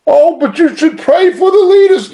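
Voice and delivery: high mock protest; mock objection. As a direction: mimic someone objecting, pitched well up. Mimics an objector, then retorts.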